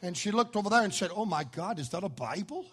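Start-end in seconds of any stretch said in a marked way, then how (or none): 1.1-2.7 a hint of a prim educated lady, softer. Lady on the plane, lightly voiced and prim. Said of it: "she talked like that".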